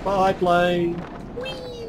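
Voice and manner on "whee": high gleeful whee; squeal. Real, not acted. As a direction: squeal like on a ride. Jumping from plane.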